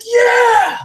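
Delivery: put-on high, loud kid cheer; excited yell.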